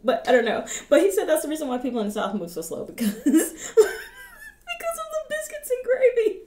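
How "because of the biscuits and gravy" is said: high, drawn-out words through tearful laughter; dying laughing. Real, not acted. Reactor repeating the biscuits and gravy joke, dying laughing. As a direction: barely get it out, laughing helplessly. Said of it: "I'm just dying because it's just so funny".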